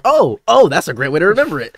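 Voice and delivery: high, loud burst on the ohs, easing off; delighted realization. Retold or imitated. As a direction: re-enact a lightbulb moment, quickening. Sudden realization in retold lesson.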